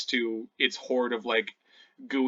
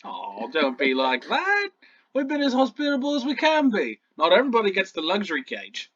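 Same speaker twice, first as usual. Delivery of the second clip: protesting goblin; 7 semitones higher, 4 dB louder than usual. Acted. Goblin jailer defending his hospitality, high wounded voice.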